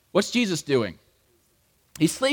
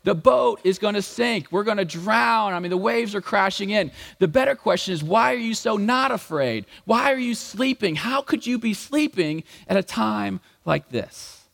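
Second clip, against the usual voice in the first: exasperated disciples; 6 semitones higher than usual. Retold or imitated. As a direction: argue back in disbelief. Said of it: "And they're like".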